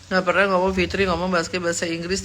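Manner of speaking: usual speaking voice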